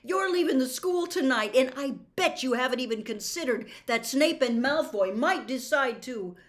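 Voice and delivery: loud, high heated argument; loud protest. Acted. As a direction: rush over him angrily. Harry keeps shouting.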